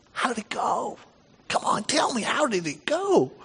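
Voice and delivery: high, hushed, excited coaxing; eager prying. Acted. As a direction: play a mother-in-law begging for news. Voicing eager mother-in-law wanting news.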